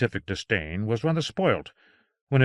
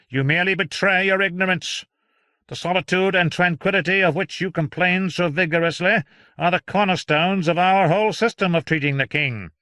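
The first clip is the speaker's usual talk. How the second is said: high, loud lecturing physician; condescending doctor; 8 semitones higher, 5 dB louder than usual. Acted. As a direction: belittle him. Voicing Doctor Robert.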